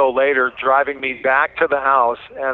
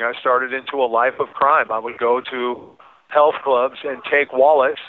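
ordinary talk, nothing marked

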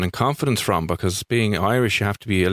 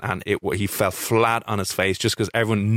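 normal talking voice

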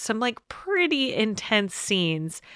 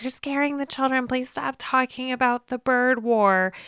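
high, mock-pleading lady; worried mother; 6 semitones higher than usual. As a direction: imitate an anxious mother begging them to stop. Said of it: "The lady who's just like".

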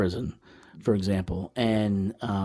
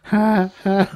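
loud, higher-pitched mock nervous laugh; imitated nervous laughter; 8 semitones higher, 6 dB louder than usual. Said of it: "like, nervous laughter".

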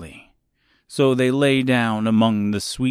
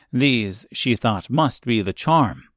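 usual speaking voice

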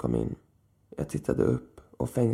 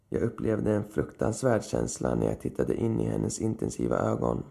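ordinary delivery, nothing marked

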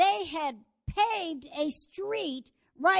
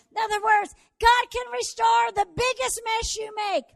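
preaching at full force; 6 semitones higher, 12 dB louder than usual. Acted. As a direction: drive the climax home to the congregation. Sermon climax at full force.

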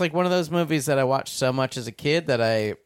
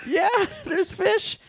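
high silly voice; slower than usual. Retold or imitated. Recalling the fish sketch.

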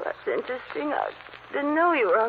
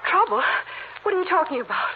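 alarmed; 6 semitones higher, faster than usual. Acted. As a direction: push back in rising panic. Margot alarmed at the threat.

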